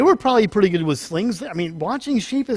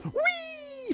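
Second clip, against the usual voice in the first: bird's joyful whee; 11 dB quieter than usual. Retold or imitated. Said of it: "And the bird goes".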